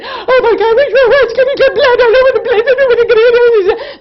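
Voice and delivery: high, loud, fast and laughing; panicked. Retold or imitated. Panicked call to the dentist.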